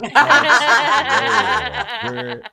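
laughing through the words. Real, not acted. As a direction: crack up while saying it. Laughing at his own joke.

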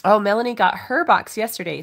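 usual speaking voice

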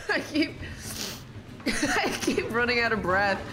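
helpless laughter breaking up the words; laughing. Real, not acted. Player amused at running out of breath, laughing. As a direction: laugh through it, stopping and restarting. Laughing at own repeated failure.

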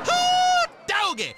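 high loud hillbilly whoop; big whoop. Acted. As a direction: holler it out in delight. Celebrating a lucky shot.

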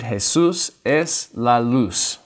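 "Jesús es la luz" read slowly, word by word, Spanish hay-SOOS; slow learner reading. Shown as a demonstration. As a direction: sound out "Jesús es la luz" like a new reader. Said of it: "They'll read".

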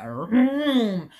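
retold chainsaw noise from the haunted house; imitated chainsaw. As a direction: hum it like a chainsaw revving at someone. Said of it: "he took that chainsaw and went".

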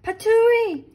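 hammed-up squeaky baby bear protest; Baby Bear crying out. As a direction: spit it out like a tiny bear who thinks he's dying. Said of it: "cried Baby Bear".

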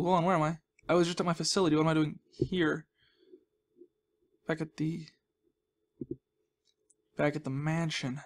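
usual speaking voice

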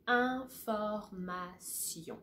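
Information very slowly with s, not sh; slow French information. Shown as a demonstration. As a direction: stretch Information, French sion. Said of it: "it's about s".

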